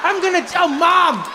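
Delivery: put-on high, whiny child's accusation; betrayed little boy. Abandoned son to his father, high tearful accusation. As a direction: wail the threat like an indignant kid.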